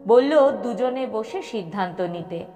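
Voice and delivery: ordinary delivery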